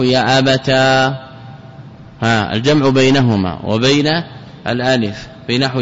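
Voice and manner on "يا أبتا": يا أبتا with a slow, held "aa" ending; long final alif. Shown as a demonstration. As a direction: draw out the alif at the end of يا أبتا. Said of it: "والجمع بينهما وبين الألف".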